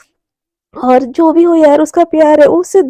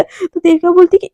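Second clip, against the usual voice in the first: usual speaking voice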